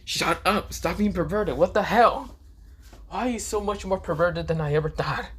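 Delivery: high, shouted rant at a pervert; angry scolding. Acted. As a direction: tell him off, outraged and exasperated. Issei scolds perverted Drake.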